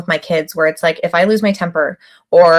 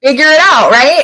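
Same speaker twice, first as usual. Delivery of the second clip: impatient retort, higher and a touch louder; mildly exasperated, pitched-up dismissal; mock exasperation; 6 semitones higher, 4 dB louder than usual. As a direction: throw it out as a retold scolding. Retelling her old impatient reaction.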